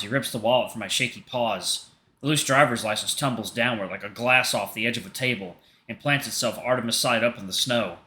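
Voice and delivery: normal talking voice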